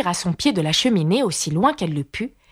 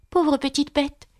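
high, coaxing tenderness; voix caressante; 7 semitones higher, slower than usual. A narrator's reading. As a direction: coo it gently to a little animal. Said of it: "d'une voix caressante". (ton caressant, doux et haut perché)

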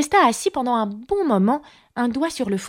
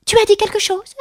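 high, challenging mouse voice; pitched-up, offended little mouse; frowning mouse; 13 semitones higher, 4 dB louder than usual. A narrator's reading. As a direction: question it sharply in a squeaky pitch. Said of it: "mais en fronçant le sourcil".

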